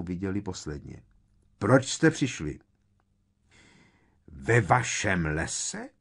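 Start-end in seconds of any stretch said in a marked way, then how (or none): 1.6-2.6 raised, stern questioning; harsh demand. A narrator's reading. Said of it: "zaborácel šedivý kentaur s nemilosrdným výrazem". 4.3-5.9 slow, incredulous outburst; outraged cry. A narrator's reading. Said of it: "rozkřikla se Umbridgeová".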